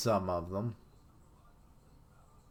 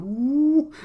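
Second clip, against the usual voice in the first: very high, loud whoop; mock whoop; 10 dB louder than usual. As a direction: cheer like a hyped bodybuilder. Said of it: "He's like".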